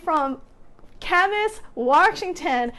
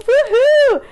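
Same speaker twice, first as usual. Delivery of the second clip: excited cheer; 7 semitones higher, 8 dB louder than usual. Real, not acted. Cheering a viewer's arrival.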